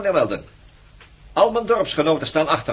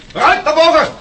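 loud command to move; barked order; 13 semitones higher, 8 dB louder than usual. Acted. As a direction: order him up the stairs, loud. Captor orders prisoner up.

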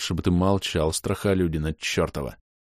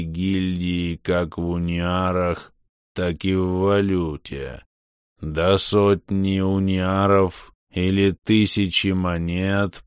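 very slow, eerie distorted voice; much slower than usual. Acted. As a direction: eldritch horror, drawn out slowly. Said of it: "жутко искажённый голос".

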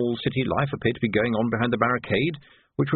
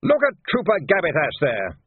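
upset major; 6 semitones higher, 3 dB louder than usual. Acted. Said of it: "now quite upset".

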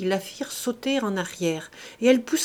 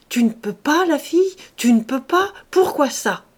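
loud, higher, indignant questions; sudden outburst of anger; 6 semitones higher, 7 dB louder than usual. A narrator's reading. Farmer flaring up at his servant's refusal, angry and loud. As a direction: fire the questions at her, incredulous and angry. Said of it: "il s'emporta tout à coup".

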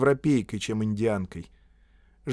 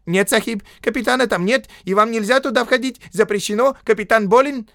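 very high, loud protest; desperate squealing; 11 semitones higher, 8 dB louder than usual. A narrator's reading. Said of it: "Индиец отчаянно завизжал". (отчаянный визгливый протест)

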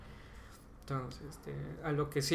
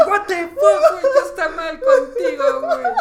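much higher, loud laughing outcry; shocked shout; 15 dB louder than usual. Retold or imitated. Friends yelling at the Kenku who stabbed a sleeper, laughing shout. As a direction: yell it in horrified disbelief, laughing. Party's horrified reaction, retold.

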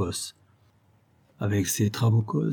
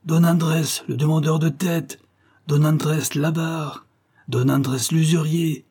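bandits howling; 6 semitones higher than usual. Acted. Bandits howling insults at the captured magistrate. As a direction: hurl each insult like a curse. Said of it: "hurlent tous les trabucaires".

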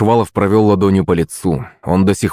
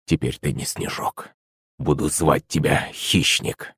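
hushed, slow admiration, low; soft and low, taking slow satisfaction; quietly pleased; 5 semitones lower, 9 dB quieter, slower than usual. A narrator's reading. Prince renaming his mutant beast, quietly pleased. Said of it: "довольно ответил Шувалов".

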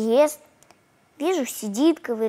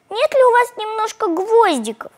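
put-on high, polite childish asking; little girl asking for tastier food; 8 semitones higher, 4 dB louder than usual. A narrator's reading. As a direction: ask sweetly like a small girl.

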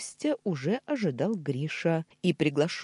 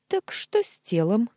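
eager louder question with a raised pitch; slightly flustered, higher and louder; fussing; 7 semitones higher, 6 dB louder than usual. A narrator's reading. Uncle asks about the body, fussing. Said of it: "засуетился дядя".

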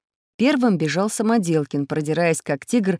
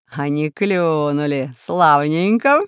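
slow, relishing drawl; gloating villain; 3 semitones lower, slower than usual. A narrator's reading. Plant lord seeing his trick work, gloating. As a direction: stretch the words with sly delight. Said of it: "радовался повелитель растений".

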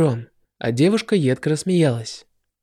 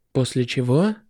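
usual speaking voice

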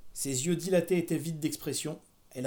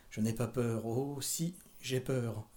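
low, hesitant, softer voice; fearful, half-swallowed; 4 semitones lower, 5 dB quieter, slower than usual. A narrator's reading. Carrie admitting her fear to her mother, low and fearful. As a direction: try to steady a frightened voice. Frightened girl swallows, fails to speak.

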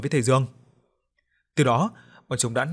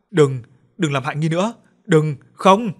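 high, loud panicked plea rising to a scream; desperate begging; 3 semitones higher, 7 dB louder than usual. Acted. Narrator begs the ghost not to hurt Nghi, panicked scream. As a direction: beg her to stop, panic growing.

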